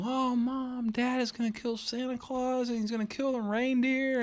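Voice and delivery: put-on high child's voice wailing to mom; panicked little kid. Young son begging his mom about Santa, high panicked child voice. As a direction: squeal it like a scared little boy.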